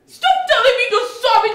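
high, loud angry yell; furious shout. Acted. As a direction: cut off his apology. She snaps at his apology.

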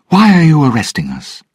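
high, loud, bold challenge; brave. Acted. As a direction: stand up to the accuser. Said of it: "Elizabeth asked bravely".

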